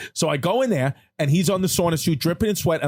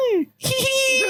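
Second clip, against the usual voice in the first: Elmo giggle. Retold or imitated. Telling what the Elmo doll says, mock giggle. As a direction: squeeze out a tickle-toy giggle. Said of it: "He just laughs".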